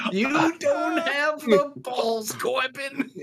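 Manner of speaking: high, slow taunting jeer; raging gamer. Acted. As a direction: jeer at him, high and drawn out. Mock taunt at friend.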